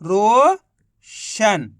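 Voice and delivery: Roshan stretched very slowly as one word; very slowly joining रो and शन; drawn-out blend. Shown as a demonstration. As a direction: draw out Roshan long and slow. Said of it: "तो मिलके बन गया".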